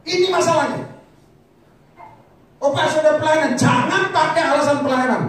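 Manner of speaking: loud, high-pitched exhortation; full-force preaching. Real, not acted. Preacher confronting those excusing themselves through ministry, full-force preaching. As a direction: drive the rebuke home at full volume.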